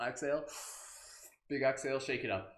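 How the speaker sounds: ordinary talk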